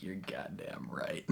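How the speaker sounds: hushed, smug whisper; a soft, smug aside in a gravelly whisper; smirking whisper. Retold or imitated. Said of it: "Ackerson gets a smirk on his face".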